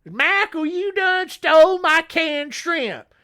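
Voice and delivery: high, loud put-on accusing voice; accusing yell. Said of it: "he goes and he accuses him".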